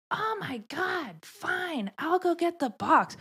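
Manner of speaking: high-pitched, drawn-out mock exasperation; imitated huffy coworker. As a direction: mimic her huffing in a high voice. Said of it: "busts up out of her seat".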